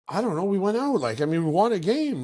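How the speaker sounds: high, innocent shrug of a reply; playing innocent. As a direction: act clueless to the GM. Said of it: "I'm like".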